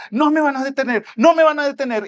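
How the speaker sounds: high, loud cry building in force; acted full-voiced defiant yell; defiant shouting. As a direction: shout it out, refusing to be stopped. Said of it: "él gritando más".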